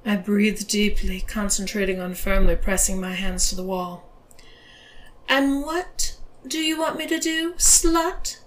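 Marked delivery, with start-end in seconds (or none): 5.3-8.4 high-pitched, taunting question; teasing dare in a raised voice; provocative. A narrator's reading. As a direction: ask it as a provocation. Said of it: "she asked provocatively".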